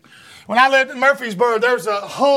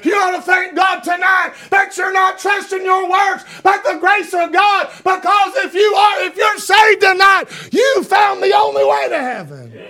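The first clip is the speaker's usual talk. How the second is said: loud, high revival preaching; fiery preaching; 5 semitones higher, 4 dB louder than usual. Real, not acted. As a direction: preach it out to the congregation. Altar-call style full-force preaching.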